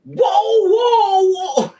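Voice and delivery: high loud siren-like whoa; whoa howl. Retold or imitated. Friend mimicking the neighbor dog's howl, loud and high. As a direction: howl it out like the barking dog.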